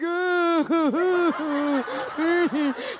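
high, drawn-out whimper; hammed-up cry trailing off in bursts; mock-pitiful wail; comic wailing sob. Groaning while getting up.

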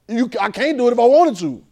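loud, high preacher's cry; preaching at full force. Acted. Preacher cries out to the congregation about his past, loud. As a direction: shout it out to the church.

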